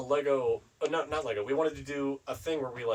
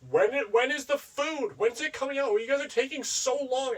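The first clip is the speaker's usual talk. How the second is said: put-on impatient whiny kid; 5 dB louder than usual. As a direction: whine like an impatient child. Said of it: "He'd be like".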